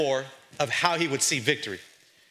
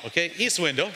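ordinary talk

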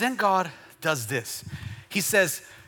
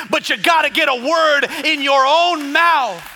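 higher, raised, stretched-out exhortation; climactic preaching shout; 8 semitones higher, 7 dB louder than usual. Real, not acted. Preacher urging listeners to speak the word, climactic shout. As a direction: drive it home and hold the last word.

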